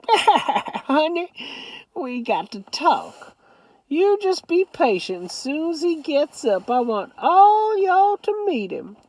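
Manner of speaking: put-on high old Southern grandmother voice; Southern grandma. A narrator's reading. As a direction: scold lovingly as a granny.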